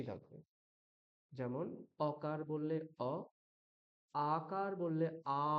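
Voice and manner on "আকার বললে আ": আ held twice as long as অ; আ দীর্ঘ করে. Shown as a demonstration. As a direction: stretch the vowel আ out slowly. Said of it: "দ্বিগুণ সময় নেবে আ".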